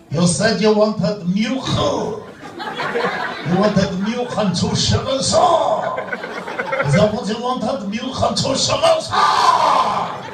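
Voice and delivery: loud, high mock Arabic accent with throaty spraying sounds. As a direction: build to a shouted, spitting Arabic-accented "Oh". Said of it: "he's, like, spitting on us".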